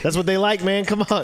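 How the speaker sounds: high hyped-up host; excited hype. Pretend. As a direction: pump it up like a game show host. Hyping Spanish TV style.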